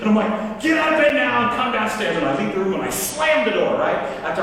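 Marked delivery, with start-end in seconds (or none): 0.6-2.2 higher-pitched angry order; retold heated command; mad. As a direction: order the kids out, voice raised in pitch. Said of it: "I was mad".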